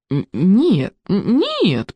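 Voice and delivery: stammered, hurried denial; hasty assurance. A narrator's reading. Boy hastily assures the witch, stammering. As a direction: stumble on the first sound, voice lifting. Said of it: "поспешно заверил Икинг".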